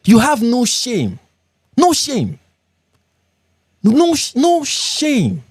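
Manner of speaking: rising, slow, mocking scold of no shame. Real, not acted. Scolding the blocked commentator.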